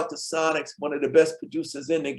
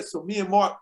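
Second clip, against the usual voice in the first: ordinary delivery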